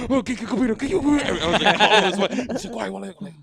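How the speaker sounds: high-pitched, mock-panicked scramble; frantic impatience. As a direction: act out your frantic hurry, high and loud. Acting out panic over slow Wi-Fi.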